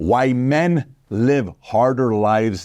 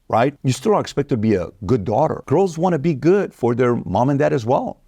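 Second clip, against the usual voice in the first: normal talking voice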